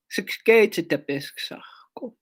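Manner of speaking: a whispered final repeat; whispered word. Shown as a demonstration. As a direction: whisper Siksikaitsitapiskasahko, rising.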